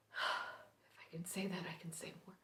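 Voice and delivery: barely audible, breath-only whisper; very hushed murmur. Acted. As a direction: whisper it under your breath, almost to yourself. Murmured lines before the monologue begins.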